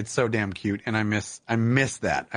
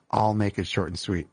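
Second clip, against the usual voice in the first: ordinary talk, nothing marked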